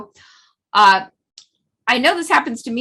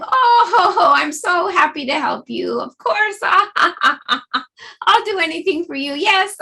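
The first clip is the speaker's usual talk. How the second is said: higher, laughing, eager to help; open-hearted laughter; 6 semitones higher, 4 dB louder, slower than usual. Shown as a demonstration. Teacher demoing open-hearted laughter to the class, warm and laughing. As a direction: gush with joy like a glad helper. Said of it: "open-hearted laughter".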